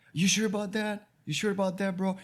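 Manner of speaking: high, sneering mock of Steve's doubt. Imitating Steve mocking the new guy, high and sneering. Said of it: "He's like".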